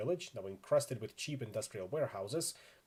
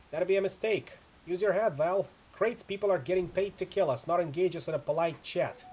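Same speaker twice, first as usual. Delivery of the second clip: loud, higher-pitched scolding; raised voice correcting a teammate; firm rebuke; 6 semitones higher, 6 dB louder than usual. Acted. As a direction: shoot down the plan forcefully. Acted leader rebukes the player's plan.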